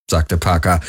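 usual speaking voice

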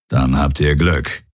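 low growl of an alien guard; low, grumbling creature voice. A narrator's reading. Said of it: "knurrte der Aur".

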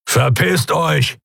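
slow rumbling growl; growling threat. Acted. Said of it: "brummte er".

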